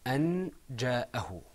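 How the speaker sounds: "أن جاءه" with a clear, short n; without ikhfa. Shown as a demonstration. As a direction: say "أن جاءه" with the n fully sounded, no long hum. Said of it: "this is pronounced without إخفاء".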